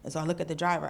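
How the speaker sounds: ordinary talk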